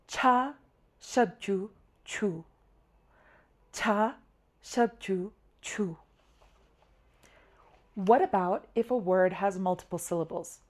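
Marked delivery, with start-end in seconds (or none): 0.1-2.4 "Cha, shub, chu, chu" in separate beats, falling away at the end; step-by-step oral spelling. Shown as a demonstration. Teacher spelling the word for water, recited step by step. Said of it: "we have to say the letter, then the vowel". 3.7-6.0 "Cha, shub, chu, chu" in separate beats, last one softer; step-by-step oral spelling. Shown as a demonstration. Teacher repeating the spelling of water, recited step by step. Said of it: "we have to say the letter, then the vowel".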